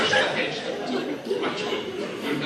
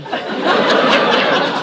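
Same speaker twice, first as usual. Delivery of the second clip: loud high laughing fit; burst of laughter; 8 semitones higher, 12 dB louder than usual. Real, not acted. Laughing hard while telling friends of the runaway horse. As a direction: break up laughing at the memory.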